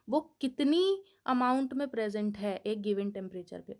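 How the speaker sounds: ordinary talk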